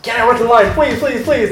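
quick pleading, pitched high; eager begging. Retold or imitated. Actor begs the boss to work the line, eager pleading. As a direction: beg like an overexcited kid.

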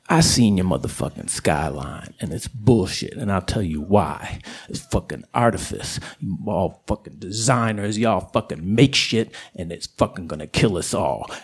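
deep, low coyote tirade; South Side coyote. Acted. Coyote explains what's wrong with humans, low and scornful. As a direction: hold it way down, scornful and certain. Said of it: "And they are like".

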